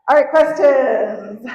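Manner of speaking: calling out over the crowd, slow; a slow, loud all right to the crowd; loud call to the room. Real, not acted. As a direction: announce it loud to get attention. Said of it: "loud call to the room".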